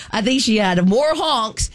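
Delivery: ordinary delivery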